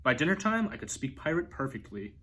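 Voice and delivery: plain reading voice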